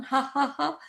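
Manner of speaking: short, high ha-ha beats; deliberate staccato laugh; laughter. Shown as a demonstration. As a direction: laugh out each pretend action. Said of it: "with a sense of fun and laughter".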